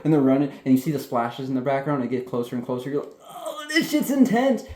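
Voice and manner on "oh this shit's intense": very high, slow squeal of excitement; mock-panicked awe, stretched out high; hammed-up thrilled reaction. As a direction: act out an overwhelmed viewer. Acts out viewer's reaction to chase.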